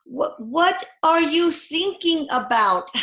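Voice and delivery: put-on slow, high, puzzled German accent; hammed-up, halting German accent; bad German accent. German Coast Guard misunderstands sinking, slow bad German accent. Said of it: "I can't do a German accent".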